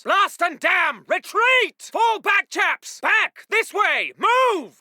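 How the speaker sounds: high, urgent shouting; shouted retreat. Acted. Officer cursing and ordering chaps to retreat, shouting. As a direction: yell to fall back.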